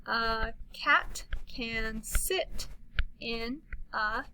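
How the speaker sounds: slow, word-by-word reading; slow modeled reading. Shown as a demonstration. Teacher modeling a sentence, slowly.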